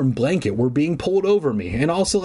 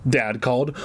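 ordinary delivery

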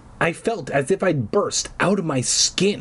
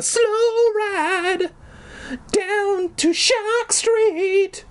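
very high, drawn-out ghostly announcing; spooky tour build-up; much slower than usual. Acted. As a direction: stretch it out like a haunted-ride host. Dad hams up the scary ride.

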